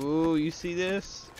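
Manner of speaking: loud, high, swaggering trash talk; cocky taunt. Pretend. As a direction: hype yourself up at the enemy. Taunting opponent.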